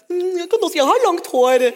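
imitating herself as a small girl, pleading; little girl. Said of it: "Husker jeg tenkte som liten jente".

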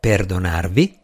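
low questioning echo, rising at the end; puzzled low-voiced question; surprised. A narrator's reading. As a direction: query the word in mild surprise, pitched low. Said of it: "Il gentiluomo parve sorpreso".

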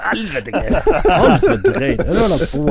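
high, laughing outrage; laughing protest. Real, not acted. (høy, leende protest)

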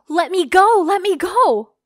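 high, loud frantic scream. Acted. Girl grabbed by her fake dad, screaming in panic. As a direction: shriek to break free of his grip. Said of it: "I started screaming".

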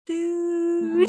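"Dude" stretched out very high. Shown as a demonstration. Trying "Dude" as British, very high and drawn out. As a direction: draw out "Dude" on a high pitch. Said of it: "changing the pitch of my voice".

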